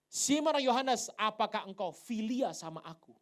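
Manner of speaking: booming challenge. Acted. Jesus asks Simon the third time, booming. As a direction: throw the question out to the hall.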